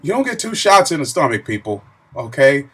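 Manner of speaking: loud, insistent correction; emphatic. Real, not acted. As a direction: set the record straight, loud.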